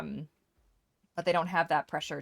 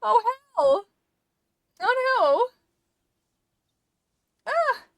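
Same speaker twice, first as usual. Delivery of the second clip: sudden high alarmed yelps; startled cries; 8 dB louder, slower than usual. Real, not acted. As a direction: caught off guard, cry out.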